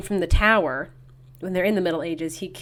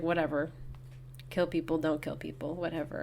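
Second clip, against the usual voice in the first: chill indifference; 4 semitones lower, 12 dB quieter than usual. Retold or imitated. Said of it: "who's just, like, chill".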